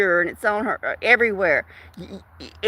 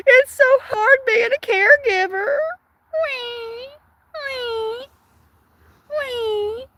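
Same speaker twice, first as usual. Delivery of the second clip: mock crybaby whine; 12 semitones higher, slower than usual. Mocking the man's caregiving complaints, crybaby wail. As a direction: wail it like a spoiled toddler. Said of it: "he whines".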